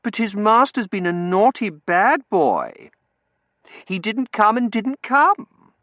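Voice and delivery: put-on slow, high, loud baby voice; drawn-out girlish mock reproach; cooing baby-talk scolding. A narrator's reading. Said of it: "Tossie cooed".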